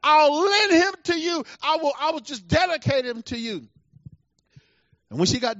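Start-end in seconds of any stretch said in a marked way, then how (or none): 0.0-3.7 high, loud acted plea, easing toward the end; impassioned mock cry to heaven, pitched high; crying out to God. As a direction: cry out a desperate prayer, then let it settle. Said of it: "she's crying".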